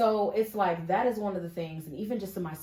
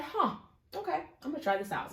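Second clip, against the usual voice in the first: high, intrigued "huh" then trailing off; curious huh; 11 semitones higher than usual. Retold or imitated. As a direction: act pleasantly intrigued by an ad. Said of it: "And I said".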